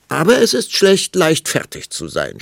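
loud, high start, dropping irritably; snorting impatiently. A narrator's reading. Queen losing patience with king, snorting retort. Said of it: "schnaubte die Königin".

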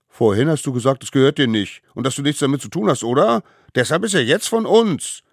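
put-on high, loud squabbling child; accusing. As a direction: fire back the accusation. Little ones argue back angrily. (laut und streitlustig)

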